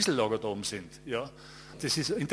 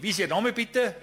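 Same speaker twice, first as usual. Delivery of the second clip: sharp retort; 9 semitones higher, 6 dB louder than usual. Retold or imitated. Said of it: "ich schieß sofort zurück".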